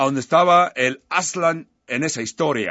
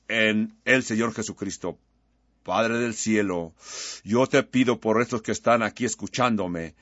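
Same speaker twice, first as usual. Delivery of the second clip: prayer; 4 semitones lower than usual. Real, not acted. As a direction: pray over the listeners, unhurried and sincere. Leads congregation in prayer.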